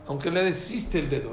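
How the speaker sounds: ordinary talk, nothing marked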